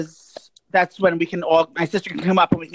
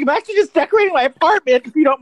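high, prim and precious, pitched well up; sweetly correcting a stranger's assumption; prissy decorator voice; 7 semitones higher, faster than usual. Retold or imitated. To a straight guy, a high prim put-down. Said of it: "I was like".

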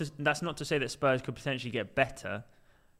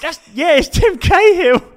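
put-on excited kids; 17 dB louder than usual. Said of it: "the two kids sat in the back are like".

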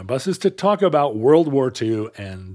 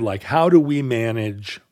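ordinary talk

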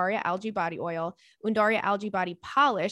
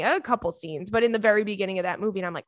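normal talking voice

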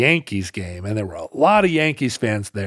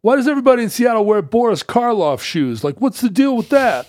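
put-on high-pitched, griping New York accent; New Yorker accent; 11 semitones higher, 4 dB louder than usual. New Yorker at the counter puzzled by shoes, in a New York accent. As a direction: demand an explanation as a caricature New Yorker. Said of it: "a guy stood there at the counter".

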